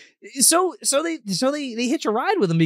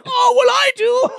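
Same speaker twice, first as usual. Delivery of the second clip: high, loud delighted retort; mock laughing king; 6 dB louder than usual. King cracks up at his own joke, mock laughing. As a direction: crow it high and laugh through it.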